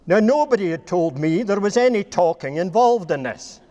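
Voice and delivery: ordinary delivery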